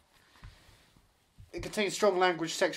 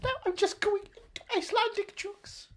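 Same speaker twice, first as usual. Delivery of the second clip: put-on halting, high-pitched caricature with an Icelandic accent; 13 semitones higher, 4 dB louder than usual. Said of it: "Very close to [name]".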